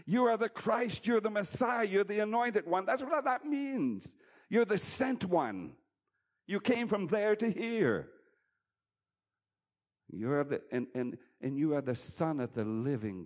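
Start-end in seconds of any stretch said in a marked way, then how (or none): 0.1-8.1 preaching. Real, not acted. Pastor proclaiming Jesus' titles to congregation.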